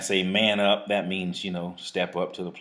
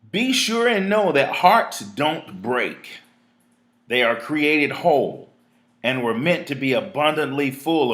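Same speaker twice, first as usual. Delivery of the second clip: emphatic, higher and louder opening, easing later; spoken-word recital with punched words; written piece read aloud; 5 semitones higher, 6 dB louder than usual. Real, not acted. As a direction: proclaim it in deliberate, spaced phrases. Reads own writing aloud to listeners.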